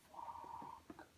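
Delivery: breathless whispered fuck; whispered curse. Real, not acted. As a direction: mouth it under your breath. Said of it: "whispered curse while waiting".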